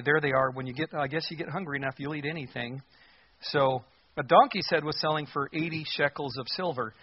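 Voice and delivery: ordinary talk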